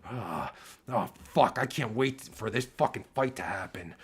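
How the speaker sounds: ordinary talk, nothing marked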